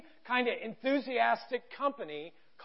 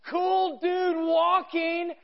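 drawn-out high, far-carrying call; imitated market vendor's holler; retold yell; 7 semitones higher, 4 dB louder, much slower than usual. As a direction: call it out slowly across a market. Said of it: "one of the guys yells out".

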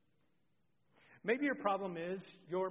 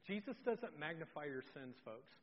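usual speaking voice

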